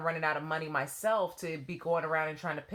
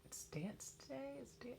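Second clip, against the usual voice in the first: whispered guess; 7 semitones higher, 17 dB quieter than usual. Retold or imitated. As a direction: murmur the guess under your breath. Thinking to herself.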